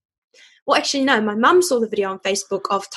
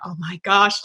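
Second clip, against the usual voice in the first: ordinary delivery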